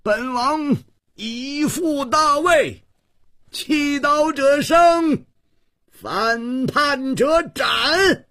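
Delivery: high, drawn-out cry of a king; king's loud proclamation. A narrator's reading. King proclaiming his restoration to rebel soldiers, loud high cry. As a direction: call it out slowly, pitched very high. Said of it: "龟兹王扬声大叫".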